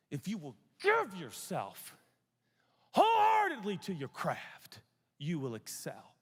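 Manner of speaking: hammed-up coach pep talk, rising to a shout; football coach. Preacher imitating a school football coach's creed, hammed-up shout. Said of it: "That coach taught from a philosophy".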